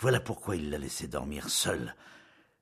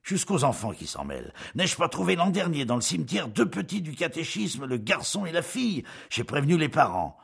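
high, louder indignant tale; 9 semitones higher, 5 dB louder than usual. A narrator's reading. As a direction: build up in scandalised indignation. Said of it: "d'un ton indigné".

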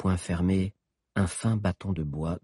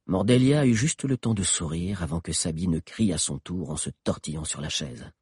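ordinary delivery, nothing marked

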